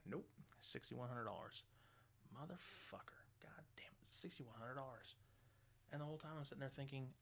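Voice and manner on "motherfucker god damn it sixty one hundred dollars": breathless whispered swearing; whispered curse. Retold or imitated. Shocked by the sixty-one hundred dollar bill, whispering curses. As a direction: curse it under your breath in disbelief. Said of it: "reacting to the price".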